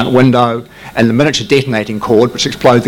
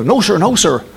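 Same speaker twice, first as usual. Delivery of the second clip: higher, dutiful no sir; radar operators answering; 8 semitones higher than usual. Retold or imitated. Radar operators answering their officer, higher dutiful voice. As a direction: report nothing seen. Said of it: "And they go".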